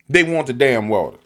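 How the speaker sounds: ordinary talk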